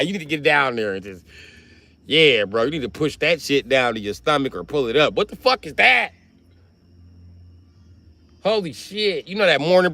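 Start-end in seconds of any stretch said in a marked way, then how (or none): none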